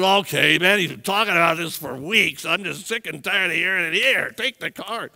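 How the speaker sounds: a hint of a fed-up churchgoer; mildly put-on grumbling giver; lightly voiced reluctant man handing over his card; grudging giver. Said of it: "Don't be the guy that said".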